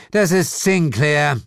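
high, slow, weary naming; bored correction. A narrator's reading. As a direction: spell it out as if tired of him. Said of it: "korrigierte Lilith gelangweilt".

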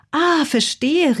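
slow, high, drawn-out aha; exclaimed realization. A narrator's reading. Bazilla grasps her hamster's hint, exclaiming high and slow. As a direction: stretch it out as the idea dawns. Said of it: "rief Bazilla".

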